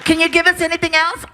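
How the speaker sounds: director over PA. Retold or imitated. Director to voice actor behind glass, tinny PA voice. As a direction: imitate a brisk director through a studio speaker. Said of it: "talk to me through a P A system".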